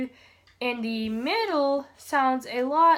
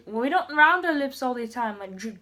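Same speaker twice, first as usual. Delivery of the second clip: ordinary talk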